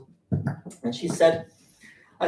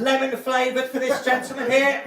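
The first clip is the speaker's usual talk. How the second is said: high, loud shop tannoy call; tannoy announcement; 10 semitones higher, 9 dB louder, slower than usual. Acted. Shop worker calling a price check over the tannoy. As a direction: blare it like a public announcement.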